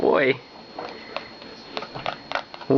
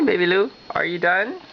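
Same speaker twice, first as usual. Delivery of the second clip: ordinary talk, nothing marked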